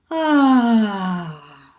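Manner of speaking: loud, open "ah" sigh; sighing vowel sound. Shown as a demonstration. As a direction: let the breath fall out on a vowel. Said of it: "maybe a sigh or kind of like".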